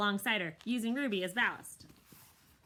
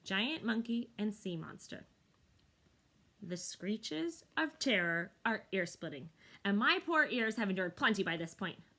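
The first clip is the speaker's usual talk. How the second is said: usual speaking voice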